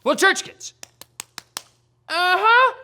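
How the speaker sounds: normal talking voice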